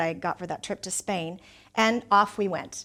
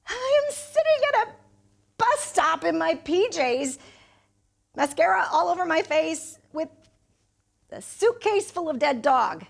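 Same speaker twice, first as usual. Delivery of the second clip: mortified outburst; 8 semitones higher than usual. Acted. Woman recounting her bus stop shame to Pete, mortified. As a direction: play up the humiliation in a high voice. Distraught retelling of humiliating scene.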